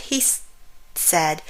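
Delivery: ordinary delivery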